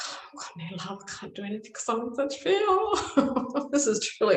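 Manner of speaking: rushed, high mock wail; retold panic. Woman unable to find love in such fear, mock panic. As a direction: whimper it fast, rising into a laugh.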